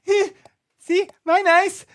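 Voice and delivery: put-on high, wobbly, tearful kid voice; a bawling toddler forcing out words; crying toddler voice. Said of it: "He goes".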